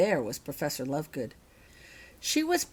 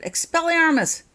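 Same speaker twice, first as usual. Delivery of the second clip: high, loud spell cast; shouted spell; 10 semitones higher, 7 dB louder than usual. A narrator's reading. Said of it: "She aimed her wand carefully and shouted".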